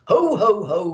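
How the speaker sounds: loud, jolly ho ho ho; Santa laugh. A narrator's reading. Santa laughs as they speed home over Devon. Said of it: "laughed Santa".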